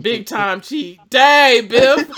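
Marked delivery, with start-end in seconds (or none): 1.1-2.1 loud, high teasing laugh; laughing exclamation. Real, not acted. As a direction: call him out while laughing.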